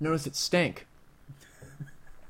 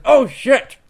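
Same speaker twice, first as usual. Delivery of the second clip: loud shocked outburst; panicked curse; 9 semitones higher, 12 dB louder than usual. Acted. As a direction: yell it in sudden alarm. Character swearing in panic.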